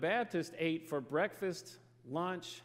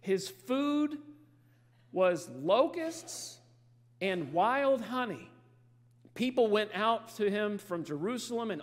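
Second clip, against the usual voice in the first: slow, deliberate Bible reading; scripture reading; 3 semitones higher, 4 dB louder, slower than usual. Acted. Preacher reading John's description to church, slow and deliberate. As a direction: lay out each detail slowly for the congregation.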